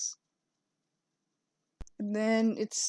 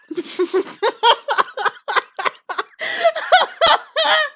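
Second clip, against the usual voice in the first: high breathless laughter; laughing fit; 5 dB louder than usual. Real, not acted. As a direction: burst out laughing.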